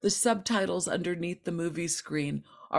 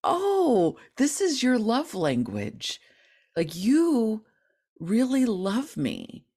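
much higher, gushing with delight; delighted mock gush; 5 semitones higher than usual. Answering her inner bully, gushing higher and delighted. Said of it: "saying back to that voice".

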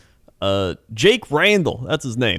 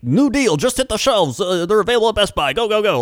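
higher, louder, rushed hype announcer; hyped Twitter deal poster; 10 semitones higher, 4 dB louder than usual. Retold or imitated. Imitating Twitter restock posters, hyped and urgent. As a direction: sell the restock like breaking news. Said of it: "people on Twitter that are like".

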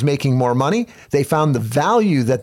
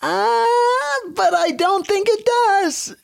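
tiny squeaky voice, pitched way up; squeaky little voice. Acted. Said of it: "do that squeaky little voice".

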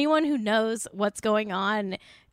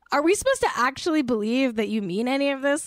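ordinary talk, nothing marked

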